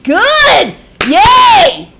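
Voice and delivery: jeering at the ex over his engagement; sarcastic cheer. Real, not acted. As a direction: cheer like it's the best news ever, meaning the opposite.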